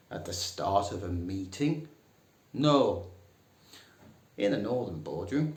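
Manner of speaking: ordinary talk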